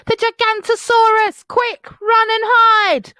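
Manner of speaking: high, loud panicked shout; cried out. Acted. Bonehead shouting to friends, high loud alarm. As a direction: bellow the warning. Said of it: "Bonehead cried".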